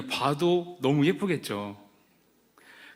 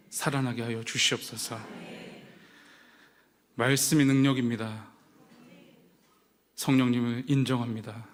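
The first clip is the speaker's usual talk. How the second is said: prayer. Acted. Pastor praying before the sermon, earnest prayer. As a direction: pray humbly before the sermon.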